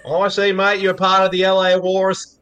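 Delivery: high, broad mock Aussie voice; exaggerated Aussie accent. Local sizing up an L A visitor, exaggerated Aussie accent. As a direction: keep the Aussie caricature pitched high. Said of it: "even more exaggerated versions of them".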